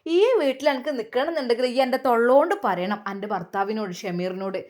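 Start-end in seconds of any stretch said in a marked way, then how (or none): none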